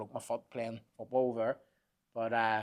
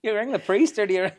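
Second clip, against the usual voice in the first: laughing disbelief; 10 semitones higher, 8 dB louder than usual. Real, not acted. Recalling the witch on the ground, laughing high disbelief. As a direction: crack up at the absurdity.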